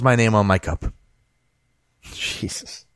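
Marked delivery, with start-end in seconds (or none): 2.0-2.8 breathless whispered exasperation; whispered jesus. Real, not acted. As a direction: breathe it out in disbelief. Disbelieving aside.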